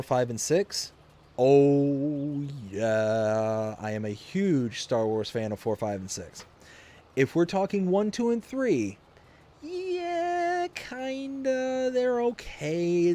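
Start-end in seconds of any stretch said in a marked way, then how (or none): 1.4-3.8 loud, long drawn-out affirmation; big, savoured agreement; emphatic yes. Real, not acted. Emphatic yes to fan question. 9.6-13.1 very high, drawn-out hedging; high-pitched, wavering so-so verdict; hesitant approval. Real, not acted. Grudging answer on the prequels.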